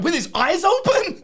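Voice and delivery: put-on very high, loud worried child; little kid voice. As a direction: squeak it like a scared small boy. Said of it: "He's like".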